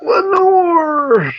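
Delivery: very high, drawn-out call; calling out a name. Shown as a demonstration. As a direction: belt it out like calling across a yard. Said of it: "I would always say".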